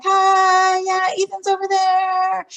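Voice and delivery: high, loud passing hello without looking up; distracted shout. Teacher tying a shoe calls to a parent, high and loud. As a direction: call it out while busy. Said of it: "tying a shoe and be like".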